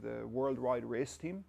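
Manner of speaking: ordinary delivery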